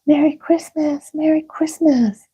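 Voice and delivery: high bright cheering; excited shouts. Acted. Children greeting Christmas morning with high cheering. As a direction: call it out with holiday joy. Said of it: "he heard the shouts of".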